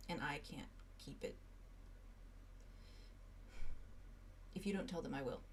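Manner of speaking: soft, near-whisper warning; hushed urgent plea. Acted. Dimitri quietly urging Silas to tell the group, hushed. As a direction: press him gently but firmly.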